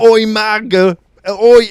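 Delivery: loud, high British holler; mock British accent. Comedian joining the bit, loud mock-British shout. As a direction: yell it like a caricature Brit. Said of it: "No, she's British".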